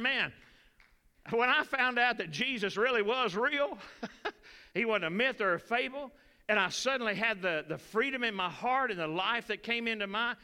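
loud, high, fervent testimony from the pulpit; preaching at full force. Acted. Preacher testifying to the congregation, full-force preaching. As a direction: lift it up and shout it to the church.

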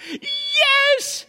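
very high, loud acted cheer; celebratory shouting. Preacher mimicking someone getting their answer, celebratory shout. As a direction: cry out in overjoyed triumph. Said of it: "then we go to shouting".

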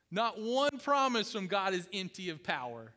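usual speaking voice

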